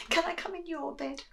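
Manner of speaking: small high mouse voice; high squeaky little mouse asking. Acted.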